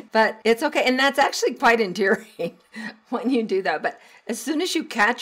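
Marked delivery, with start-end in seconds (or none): none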